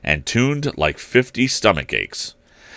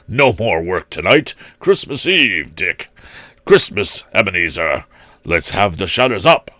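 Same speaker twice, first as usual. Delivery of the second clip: put-on jovial old master. A narrator's reading. Fezziwig announcing Christmas Eve.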